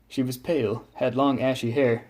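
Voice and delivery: usual speaking voice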